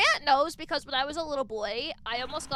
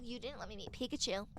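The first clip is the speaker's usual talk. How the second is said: softer mock grumble, "Pikachu" falling away; retold complaint; 4 semitones lower, 10 dB quieter than usual. Sister retelling her long argument with brother, soft retold complaint. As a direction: grumble the old grievance under your breath. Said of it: "I kept saying".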